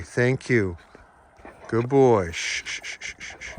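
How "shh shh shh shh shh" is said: soft unvoiced shushing; soothing shush. Real, not acted. Owner softly shushing her nervous dogs. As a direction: hush the nervous animals. Hushing animals.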